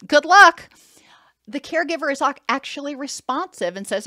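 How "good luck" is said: loud laugh, then a flippant "good luck"; mocking laugh. Dismissive caregiver brushing off tired Johnny, loud mocking laugh.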